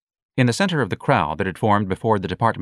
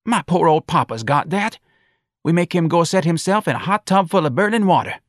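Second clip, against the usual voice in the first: high-pitched local woman gossiping; animated shop-counter chatter, pitched up; chatty, sympathetic shopkeeper; 10 semitones higher, 5 dB louder than usual. Acted. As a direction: commiserate in a high voice. Shopkeeper character chats with customer.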